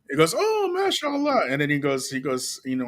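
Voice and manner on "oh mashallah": a high, slowed "mashallah", lightly imitated; mock-delighted Yemeni brother, stretched out; impressed shopkeeper. Retelling how the Yemeni brother reacted, lightly imitated high and slow.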